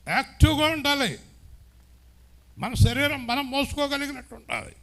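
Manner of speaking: high, loud, pressing pulpit voice; fervent preaching. Acted. Pastor scolding lazy believers, preaching at full force. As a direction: drive the rebuke home to the crowd.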